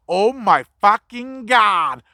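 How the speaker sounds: slow, drawn-out horrified oath; imitating her slow, appalled cry; disgusted gasp. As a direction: stretch it out like you just saw maggots. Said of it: "All I hear is".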